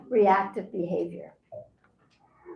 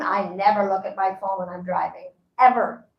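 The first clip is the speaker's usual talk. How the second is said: ordinary talk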